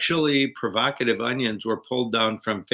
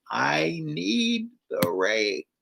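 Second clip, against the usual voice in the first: very slow, drawn-out words, raised pitch; exaggerated mouthing; 5 semitones higher, 4 dB quieter, much slower than usual. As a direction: mouth it big for someone who can't hear. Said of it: "and then he mouths".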